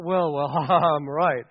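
slow, self-satisfied chuckle; hammed-up know-it-all; mock smugness. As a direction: laugh it off as if obviously right. Voicing our smug self-assumption.

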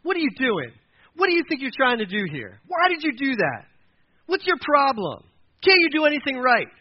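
high, loud acted scolding; angry berating. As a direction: lay into someone with exasperated outbursts. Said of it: "being angry with someone and say".

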